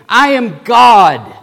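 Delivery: loud, high, slow declaration; powerful proclamation. Acted. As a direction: thunder it with full authority. Proclaims as Jesus over Satan.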